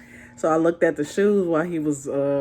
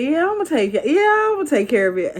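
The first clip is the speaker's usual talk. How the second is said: higher, bright mock eagerness; eager reply; 10 semitones higher, 5 dB louder, faster than usual. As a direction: play it up like a keen yes. Retelling her eager reply.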